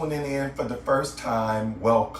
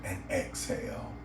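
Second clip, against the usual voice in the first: guided meditation; 5 semitones lower, 8 dB quieter than usual. Acted. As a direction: guide the listener gently into relaxation, lower and softer than a greeting.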